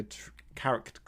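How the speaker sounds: stumbling over "character", the word breaking off unfinished; tongue-tied stumble. Real, not acted. Speaker losing the words "character trait" mid-podcast, tongue-tied stumble. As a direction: trip on the end of "character" and falter. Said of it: "I can't even talk anymore".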